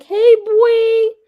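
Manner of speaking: put-on high, loud little-kid voice; sweet little boy voice. Parent imitating a small boy's greeting, sweet high voice. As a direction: call out like a tiny boy. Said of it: "his sweet little voice".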